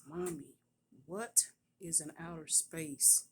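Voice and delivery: drawn-out retold demand; irritated authority, imitated. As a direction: mimic a fed-up little girl demanding an answer. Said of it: "she said it with such authority, like she was irritated".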